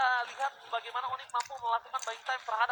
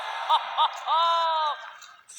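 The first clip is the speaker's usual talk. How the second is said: excited shouting laugh; 4 semitones higher, 9 dB louder than usual. Real, not acted. Caster reacts to big play.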